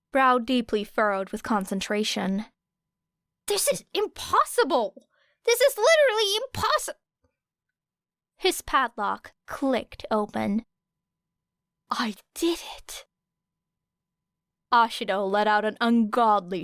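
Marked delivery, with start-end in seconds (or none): 3.5-7.0 high, rising exasperated whine; exasperated complaint. Acted. Kaminari complaining about the lock, exasperated. 11.9-13.1 breathy triumphant whisper; excited whisper. Acted. Kaminari's padlock opens, a whispered cheer.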